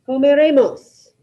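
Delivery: ordinary talk, nothing marked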